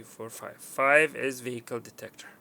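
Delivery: ordinary talk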